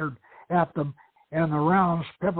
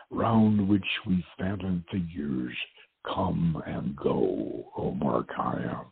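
slow, lower, solemn verse reading; poem recitation; 6 semitones lower, slower than usual. A narrator's reading. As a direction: let each line sink slowly and gravely. Chapter epigraph poem read.

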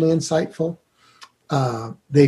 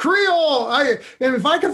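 a laughing word, pitched up high; 10 semitones higher than usual. Real, not acted. Laughing about Southern food.

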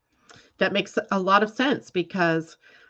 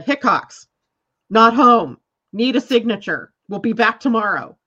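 mock sign reading; 2 semitones higher, 8 dB louder than usual. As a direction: read the note out loud with scorn. Reading the delivery sign aloud.

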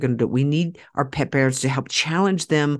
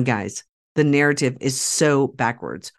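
ordinary talk

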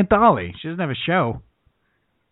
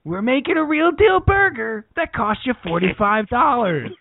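high-pitched, hyped-up burger pitchman; hammed-up TV food host selling hard; Guy Fieri; 6 semitones higher than usual. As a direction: pitch it high, like a brash showman. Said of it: "Guy Fieri's thirty dollar Burgers".

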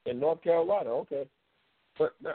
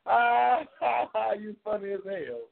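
laughing; 6 semitones higher, slower than usual. Real, not acted. Laughing at viewer question.

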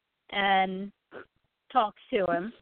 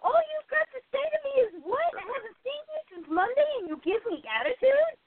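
upset; 12 semitones higher than usual. Retold or imitated. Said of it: "I got upset".

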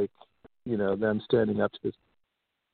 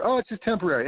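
higher, sing-song dismissive oh; mocking what authorities kept saying, higher sing-song; mocking parody; 7 semitones higher, faster than usual. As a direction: parody the officials' reassurance. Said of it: "I remember them saying".

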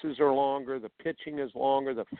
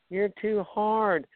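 mock complaining parent; 8 semitones higher, slower than usual. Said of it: "just complaining".

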